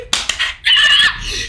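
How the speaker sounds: very high scream of excitement; high excited shriek. Real, not acted. Streamer realizing a rank-up game, excited shriek. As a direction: shriek at the top of the voice.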